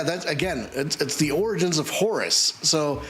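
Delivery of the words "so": upbeat teaser announcer voice; trailer narration. Acted. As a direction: hype the next episode like a promo. Episode teaser recap.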